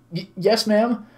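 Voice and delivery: high, stammered meek reply; mewling squeak. Acted. Scolded visitor answers the woman, mewling squeak. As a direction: squeak out timid obedience. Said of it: "my mewling squeak".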